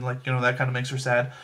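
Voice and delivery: ordinary talk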